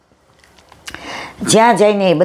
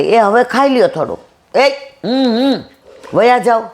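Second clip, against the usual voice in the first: calling out; 6 semitones higher than usual. Acted. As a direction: shout after him to stop and eat. Soldiers call after running man. (દોડતા માણસને બોલાવતા સૈનિકો, ઊંચા અવાજે બૂમ)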